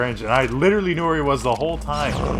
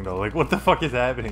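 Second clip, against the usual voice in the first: normal talking voice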